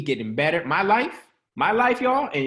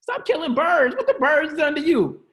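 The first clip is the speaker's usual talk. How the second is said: mock outrage; 9 semitones higher than usual. Mock pleading with bird killers.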